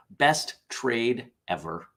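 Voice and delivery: normal talking voice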